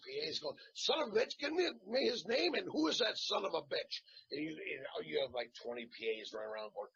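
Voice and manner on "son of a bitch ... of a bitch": high-pitched mock outrage from a flustered assistant; irate retold demand. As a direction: imitate an irate set boss, voice jumping up high. Irate crew member retold on set.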